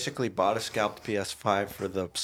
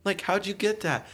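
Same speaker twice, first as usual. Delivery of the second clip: higher excited friend; 11 semitones higher than usual. Retold or imitated.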